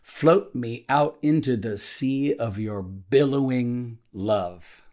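measured devotional reading with deliberate pauses between phrases; slow prayer reading. Real, not acted. Pastor reading a morning prayer to devotional listeners, slowly and deliberately. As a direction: read the prayer slowly, setting each phrase apart. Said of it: "I'm gonna read it slowly".

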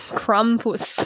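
Krampus in a German accent, "oo" in the last syllable. Shown as a demonstration. Podcaster showing her co-host the German way, German accent. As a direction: say "Krampus" with a German "poos" ending. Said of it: "that's how you say it in the German accent".

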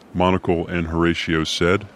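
usual speaking voice

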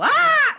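very high imitated scream; mock shriek at a sudden scare; freak-out shriek. Adult seeing a spider in front of a child, high mock scream. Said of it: "and I freak out".

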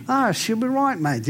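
light imitation of an Australian accent; lightly imitating an Australian on a plane. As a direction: toss it off like a friendly Australian. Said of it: "talking to me like an Aussie".

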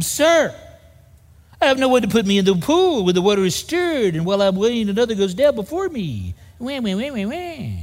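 mock whining, tailing off into wah wah; whiny complaint. Preacher mocking the sick man's excuse, whiny and plaintive. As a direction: whine like a self-pitying sick man. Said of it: "Added the wah, wah, wah part".

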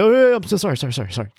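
flustered apology. Retold or imitated. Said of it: "he's like".

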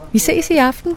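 mock shout to someone across the office; lightly voiced call from a distance. A narrator's reading. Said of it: "råber Marie fra sin plads".

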